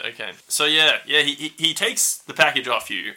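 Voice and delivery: ordinary delivery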